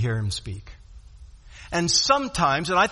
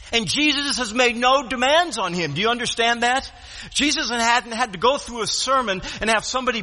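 higher-pitched, driving sermon delivery; impassioned preacher rolling through scripture; forceful preaching; 6 semitones higher than usual. Real, not acted. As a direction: press the point home to the congregation without letting up.

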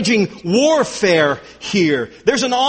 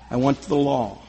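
much lower and softer, almost to himself; hushed low echo; 9 semitones lower, 5 dB quieter than usual. Acted. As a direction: murmur it as an inward confession. Hushed repeat of earlier line.

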